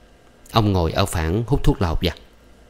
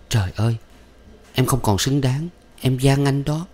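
higher, tearful begging; sobbing plea; 6 semitones higher than usual. A narrator's reading. Thuận begging her lover through tears, pitched up high. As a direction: plead through tears, voice climbing up. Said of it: "Thuận lại bật khóc".

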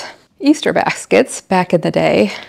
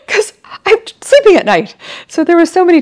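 very high, louder laugh-cry; laughing wail; 12 semitones higher, 5 dB louder than usual. Real, not acted. Mom joking about tooth fairy duty, laughing and wailing. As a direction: whine it through a laugh.

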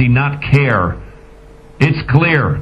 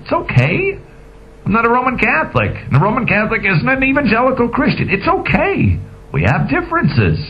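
mock reassurance; 9 semitones higher than usual. Mock-soothing talk to "postmodern babies.

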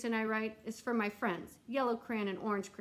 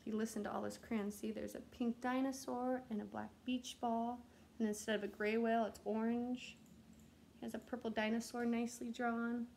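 softer bedtime-story narration; soft story ending; 7 dB quieter than usual. A narrator's reading. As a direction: wind the story down gently and softly. Narrator ends story softly.

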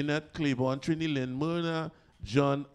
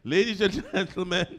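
announcer voice; 9 semitones higher than usual. Acted. Host announcing like an MC.

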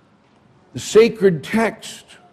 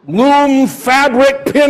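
ordinary delivery

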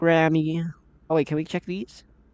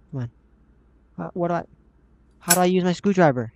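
ordinary talk

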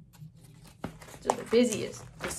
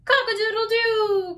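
rooster crowing; 11 semitones higher, 6 dB louder than usual. A narrator's reading. Teacher voicing the rooster for children, high loud crowing. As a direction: crow it out like a rooster. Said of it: "crowed the rooster".

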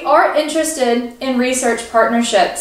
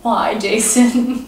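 slow, drawn-out mock lament; dramatic sigh; much slower than usual. Real, not acted. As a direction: plead slowly in mock despair.

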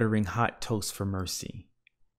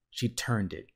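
ordinary delivery, nothing marked

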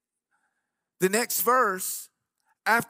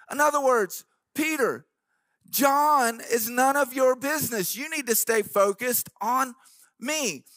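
rising, louder sermon delivery with organ behind; preaching at full force; 4 semitones higher, 4 dB louder than usual. Acted. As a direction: drive the point home to the congregation.

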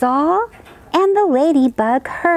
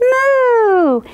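loud high mooing cow; 11 semitones higher, 4 dB louder than usual. Acted. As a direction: moo like a cow. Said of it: "the cow said".

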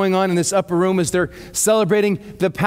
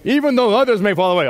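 cocky Peter; faster than usual. Retold or imitated. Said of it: "in the full Peter Pan".

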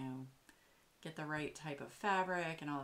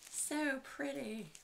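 higher, slow drawn-out so pretty; delighted gush; 9 semitones higher, slower than usual. Real, not acted. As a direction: swoon over it, stretching each word. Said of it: "this is so pretty".

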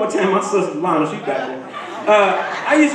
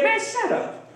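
higher, scornful brush-off; mock dismissive king; 9 semitones higher, 6 dB quieter than usual. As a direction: wave the prophet off with contempt. King Ahab dismissing the prophet.

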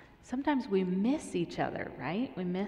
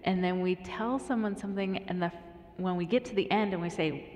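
usual speaking voice

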